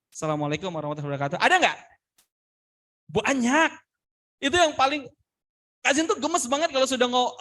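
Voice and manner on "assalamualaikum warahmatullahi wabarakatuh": very fast, low, swallowed greeting; rushed greeting. Shown as a demonstration. Trainer mimics the greeting students must avoid, rushed and low. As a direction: race through it as an unready speaker. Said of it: "Jangan sampai".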